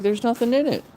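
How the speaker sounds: high, squeaky put-on dog voice; the owner speaks for her dog, high and squeaky; voicing the dog. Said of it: "She's like".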